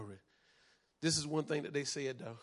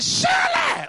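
shouted quote; 15 dB louder, slower than usual. Acted.

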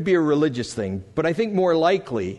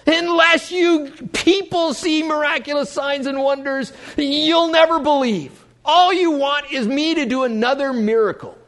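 high, raised voice of reproach; stern rebuke; 10 semitones higher, 4 dB louder than usual. Acted. Jesus answering the begging official and crowd, sharp rebuke. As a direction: scold the whole crowd, pitched well up. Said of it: "Jesus rebukes the man".

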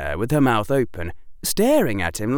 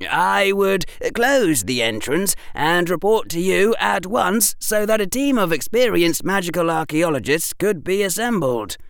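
put-on higher-pitched boy answering readily; precocious pupil, voice pitched up; prompt schoolboy answer; 8 semitones higher than usual. A narrator's reading. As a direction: reel off the sensible plan without hesitation. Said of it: "Harry said promptly".

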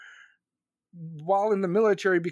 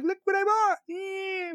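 mocking the spender; 11 semitones higher, slower than usual. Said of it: "Be like".

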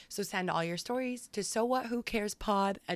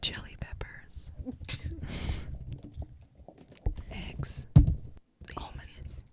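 slow, drawn-out whispered words, long pauses; whispered list; 2 semitones lower, 6 dB quieter, slower than usual. Acted. Reading a typed list aloud, slow whisper. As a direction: breathe each word like an ASMR read.

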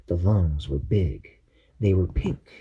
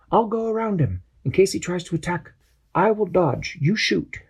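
higher, lively captain giving orders; captain briefing his crew, voice pitched up; Captain Barnacle voice; 9 semitones higher than usual. Acted. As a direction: call the plan like a brave little captain.

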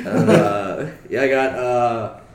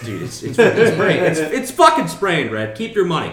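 louder, higher, exasperated protest; exasperated shout; 9 semitones higher, 7 dB louder than usual. Retold or imitated. Refusing the friend's Uber card, a raised exasperated shout. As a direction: yell it, fed up.

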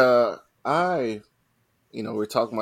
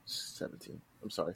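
barely audible, voiceless murmur; muttered to self; 5 semitones lower, 18 dB quieter than usual. Real, not acted. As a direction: murmur it under your breath while double-checking. Checking own age under breath.

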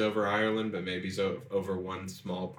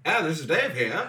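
higher, louder pretend phone voice; mock phone answer; 8 semitones higher, 5 dB louder than usual. Dave picks up the phone, playful put-on voice.

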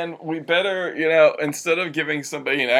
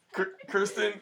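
ordinary delivery

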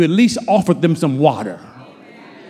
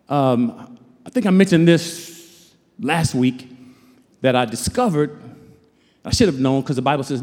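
ordinary talk, nothing marked

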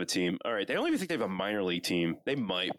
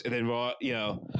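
ordinary talk, nothing marked

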